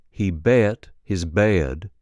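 slow Alabama accent, eh stretched into a diphthong; Alabama accent drill. Shown as a demonstration. Coach modelling the eh diphthong, slow Alabama accent. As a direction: draw out bet, bed, bred Southern-style. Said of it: "turns to the diphthong eh".

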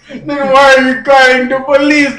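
put-on fast, loud comic Jamaican accent. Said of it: "He's from Jamaica".

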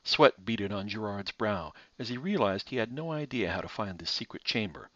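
usual speaking voice

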